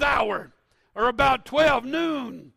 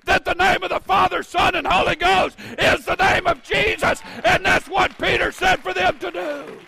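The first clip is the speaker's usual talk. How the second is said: preaching; 6 semitones higher, 5 dB louder than usual. Acted. Preaching at full force.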